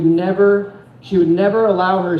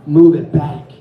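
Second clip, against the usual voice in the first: angry demand; 3 semitones lower, 5 dB louder than usual. Retold or imitated. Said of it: "She says".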